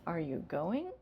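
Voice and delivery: going rises sharply at the end; rising question. Shown as a demonstration. Teacher modeling a yes-no question rising. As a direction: lift the voice on going. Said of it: "You hear that?".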